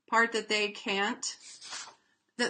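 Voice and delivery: normal talking voice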